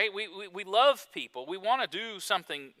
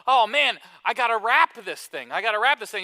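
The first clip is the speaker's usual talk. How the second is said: louder, higher, hammed-up last-minute panic; mock panic; 4 semitones higher, 7 dB louder than usual. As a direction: play the frantic shopper realizing he must wrap. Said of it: "and then you think".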